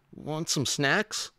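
slow, high-pitched coaxing question; high little-dinosaur plea; hopeful offer. A narrator's reading. Minosaur makes a hopeful offer.

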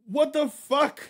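high incredulous outburst; can't believe it; exasperated. Real, not acted. Streamer reacting to chat in disbelief, high.

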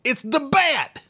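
very high henchman cry; shriller, hammed-up game goon alarm; henchman's yell. Game henchman warning the others, high-pitched mock yell. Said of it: "henchman in an Arkham game who yells".

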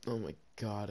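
ordinary talk, nothing marked

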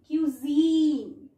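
क्यूजीन said slowly with a long held ee; long ee sound. Shown as a demonstration. Teacher showing the long sound, क्यूजीन drawn out on the ee. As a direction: stretch the zeen of क्यूजीन. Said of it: "हमारा ई का है long sound".

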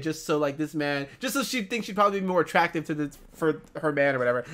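low, soft, rushed mumble; real fast, trailing mumble; mumbling. Real, not acted. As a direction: mumble it quickly under your voice. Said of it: "I'm mumbling so much right now".

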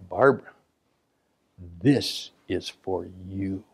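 slow, hushed and intimate; soft dedication. Retold or imitated. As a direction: say it slowly as a personal gift. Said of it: "and I would say".

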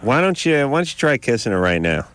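normal talking voice

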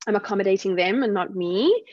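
ordinary talk, nothing marked

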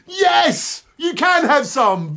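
high-pitched, drawn-out yes; mock exclamation. Host granting listeners some sandwich, high mock exclamation. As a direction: exclaim it high, stretching the words. Answers own question in high voice.

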